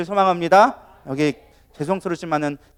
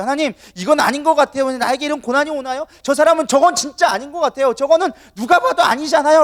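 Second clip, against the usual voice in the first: higher-pitched, indignant prayer; angry complaint; 6 semitones higher than usual. Acted. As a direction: protest to God with mounting annoyance. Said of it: "라고 하면서 화를 내기 시작하는". (화가 나서 따지는 기도)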